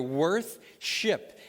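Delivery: "worth" then "ship", with a pause between the parts; split into two words. Shown as a demonstration. Preacher explains the word to the church, splitting it slowly. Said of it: "It's two words joined together".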